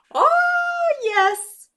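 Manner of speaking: very high, loud squeal; excited. Acted. Answering the children that the tarsier is awake, thrilled. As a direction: squeal it with delight.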